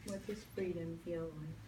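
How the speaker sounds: calm meditation voice with long pauses; guided meditation. Acted. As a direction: lead them gently into stillness.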